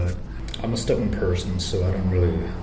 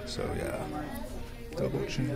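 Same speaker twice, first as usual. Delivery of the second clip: hushed, self-conscious murmur; 14 dB quieter than usual. Real, not acted. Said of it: "Trying to make it look like I'm just checking on my phone".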